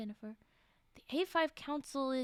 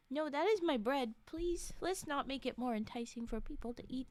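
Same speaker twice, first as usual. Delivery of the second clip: usual speaking voice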